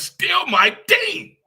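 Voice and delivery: high, slow, loud shout; loud declaration. Real, not acted. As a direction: shout your loyalty to the team. Passionate fan declaration.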